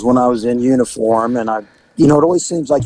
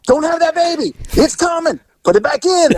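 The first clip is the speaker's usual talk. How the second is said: high, frantic begging; begging the baby to stop; panicked pleading. Retold or imitated. Two officers pleading with the mother, high and frantic. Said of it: "We're like".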